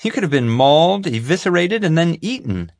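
relishing the gory news; savoring every word with glee; gleeful. A narrator's reading. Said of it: "Summer said gleefully".

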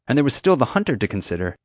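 plain reading voice

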